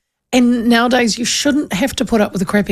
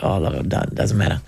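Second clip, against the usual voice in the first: much lower, softer, easygoing bloke voice; young tradesman; 13 semitones lower, 5 dB quieter than usual. Retold or imitated. Host imitates the surveyor brushing off her apology in a low voice. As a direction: shrug it off like a relaxed young surveyor. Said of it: "He's like".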